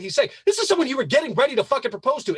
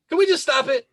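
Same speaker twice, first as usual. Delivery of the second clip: ordinary talk, nothing marked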